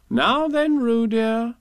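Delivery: high, loud motherly singsong; brisk motherly Kanga. Acted. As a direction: sing out to your little one. Kanga begins her joke.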